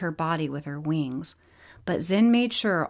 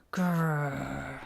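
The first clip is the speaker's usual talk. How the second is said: low, softer growl of anger; angry growl; 3 semitones lower, 4 dB quieter than usual. Acted. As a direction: growl under the breath.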